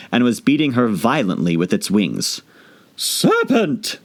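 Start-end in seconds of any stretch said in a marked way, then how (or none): 3.0-4.0 very high accusing cry; screaming pigeon. Acted. Pigeon screaming at Alice. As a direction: shriek it at the serpent. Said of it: "screamed the pigeon".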